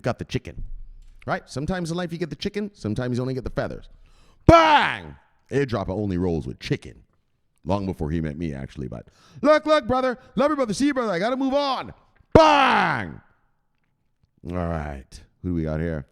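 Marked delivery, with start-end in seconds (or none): 4.5-5.1 much higher, louder bang; triumphant shout. Real, not acted. Celebrating to chat, loud high triumphant shout. 9.4-13.2 much higher, louder bro goodbye; hyped farewell. Real, not acted. Saying bye to a viewer, loud high hype.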